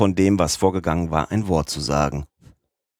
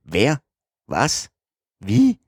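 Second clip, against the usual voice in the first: volley of higher, incredulous questions; cries from all sides; 4 semitones higher, slower than usual. A narrator's reading. Passengers round on Passepartout from all sides, incredulous calls. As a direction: toss out short questions, peaking on the third. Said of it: "rief man von allen Seiten".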